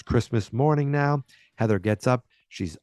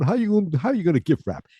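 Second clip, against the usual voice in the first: Fonzie mocking; 8 semitones higher than usual. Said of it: "He's like".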